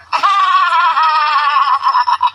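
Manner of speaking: loud, high burst of laughter. Real, not acted. Old woman cracking up at her own joke, loud laugh. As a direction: crack up loudly.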